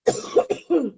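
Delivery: sharp cough from swallowing wrong; choking cough. Real, not acted. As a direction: cough hard to clear throat. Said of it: "j'ai avalé de travers". (toux d'étouffement)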